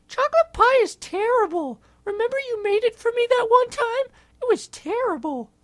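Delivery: very high, loud squeaky whine; high monkey voice. Acted. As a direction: complain like a cartoon monkey. [name] in a high voice complains.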